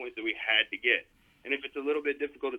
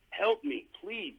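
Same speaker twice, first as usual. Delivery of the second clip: exasperated plea; 8 semitones higher, much slower than usual. Pretend. Mock despair over player.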